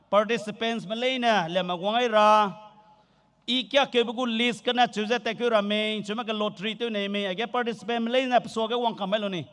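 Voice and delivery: usual speaking voice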